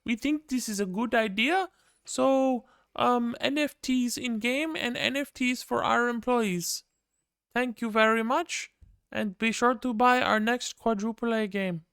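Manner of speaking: high, put-on French accent, mocking a CEO; Yves Guillemot impression. Streamer mocks Ubisoft's CEO with a high French accent. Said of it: "my best Yves Guillemot impression".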